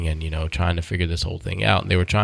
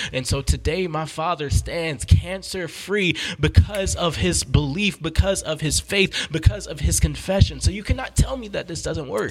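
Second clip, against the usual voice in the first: higher-pitched, urgent testimony; impassioned preaching; 7 semitones higher than usual. Real, not acted. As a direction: press the listener like a preacher.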